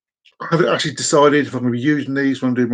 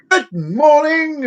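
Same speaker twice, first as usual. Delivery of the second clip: high, drawn-out sing-song greeting; cheery greeting; 10 semitones higher, 3 dB louder, much slower than usual. Acted. Worker going into boss's room, cheery greeting. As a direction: sing out a long, high hello. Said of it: "going into my boss's room in the morning and going".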